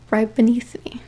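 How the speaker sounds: a chilling secret, whispered; eerie whisper. Acted. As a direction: breathe the words out, no voice at all. Creepy reveal in the story.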